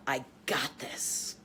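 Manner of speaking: low, soft and slow affirmation; quiet self-talk. Shown as a demonstration. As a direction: murmur it to yourself slowly. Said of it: "say to yourself".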